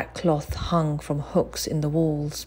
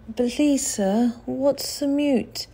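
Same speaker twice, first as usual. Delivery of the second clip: put-on timid boy; 9 semitones higher, slower than usual. Timid boy asks undertaker.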